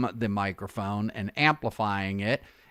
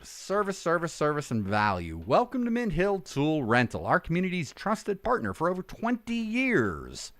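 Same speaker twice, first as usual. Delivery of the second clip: upbeat, higher-pitched announcer read; 7 semitones higher than usual. Shown as a demonstration. Voice artist reading a rental company welcome, upbeat announcer. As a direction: sell it brightly, lifting each phrase high. Reads tool rental ad aloud.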